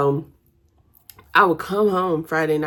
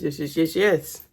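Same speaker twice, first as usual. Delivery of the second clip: ordinary talk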